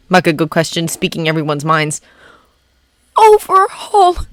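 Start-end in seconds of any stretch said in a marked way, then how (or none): 3.2-4.3 high, slow whimper. Acted. Midoriya whimpering the villain's name in fear. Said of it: "Midoriya whimpered".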